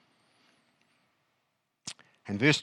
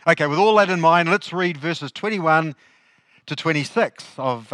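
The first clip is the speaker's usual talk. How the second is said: normal talking voice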